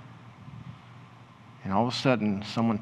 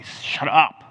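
sudden loud, higher-pitched silencing; acted rebuke; 4 semitones higher, 8 dB louder than usual. Crowd rebuking the shouting blind men, sharp loud rebuke. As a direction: burst out to silence a beggar. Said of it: "get down and say".

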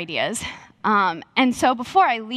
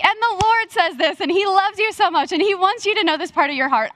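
high, rushing prophetic prayer; excited, high-pitched prayer outpouring; fervent praying; 9 semitones higher than usual. Retold or imitated. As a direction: imitate someone praying with sudden confidence. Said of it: "She starts praying for me".

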